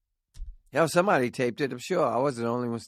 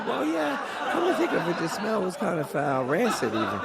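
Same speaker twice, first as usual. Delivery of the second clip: neighbor voice; 9 semitones higher than usual. Acted.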